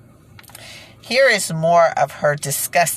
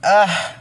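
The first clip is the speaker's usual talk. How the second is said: a short, louder groan of disgust; 4 semitones higher, 5 dB louder than usual. Real, not acted. Reacting to words she finds gross, a disgusted groan. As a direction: groan out loud in revulsion. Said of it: "it's not like a laugh. It's like a, ugh".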